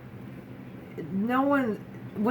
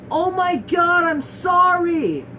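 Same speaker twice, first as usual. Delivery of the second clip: much higher, louder, drawn-out mock apology; over-the-top pity; 9 semitones higher, 7 dB louder, slower than usual. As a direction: imitate someone hugely overreacting with sympathy. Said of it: "so over the top".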